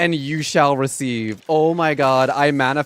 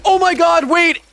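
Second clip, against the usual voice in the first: high, loud shriek of disbelief; yelling at the discovery, loud and high; excited shout; 11 semitones higher, 6 dB louder, slower than usual. Real, not acted. Player realizing she caught the rare fish, shrieking high and loud.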